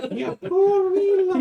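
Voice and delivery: very high, loud mock Scottish nanny; Mrs Doubtfire impression. Player mocking the barmaid as Mrs Doubtfire, high and loud. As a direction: shriek it like a pantomime dame. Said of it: "it's Missus Doubtfire".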